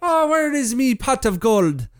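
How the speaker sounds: lightly voiced playful leprechaun. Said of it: "I just made him a leprechaun".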